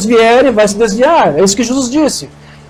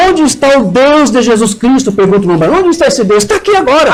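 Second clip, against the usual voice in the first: higher-pitched, urgent preacher's cry; fervorous preaching; 4 semitones higher than usual. Real, not acted. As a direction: proclaim it to the congregation with fervor. Preaches to congregation. (pregação fervorosa)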